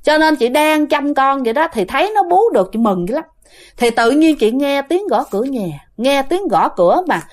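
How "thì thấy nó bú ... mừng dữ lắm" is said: normal talking voice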